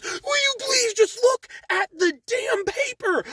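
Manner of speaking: high, slow, strained mock shout; imitating a furious plea; hammed-up yelling. Customer yelling at the manager about his receipt, hammed-up yell. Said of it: "Stop yelling at me".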